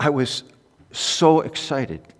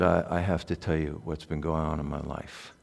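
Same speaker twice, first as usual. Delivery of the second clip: quiet confession; 8 semitones lower, 11 dB quieter than usual. Retold or imitated. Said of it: "he said".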